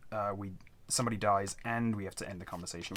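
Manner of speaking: ordinary delivery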